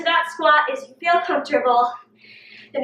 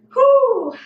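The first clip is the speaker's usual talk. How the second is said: winded whew; 10 semitones higher, 5 dB louder than usual. Real, not acted. Trainer mid-lunges exhales.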